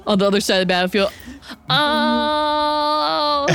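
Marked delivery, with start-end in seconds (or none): 1.7-3.5 long, high "ohhh" of reaction; drawn-out "oh". Real, not acted. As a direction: stretch out a high jeering "oh". Table jeers after the insult.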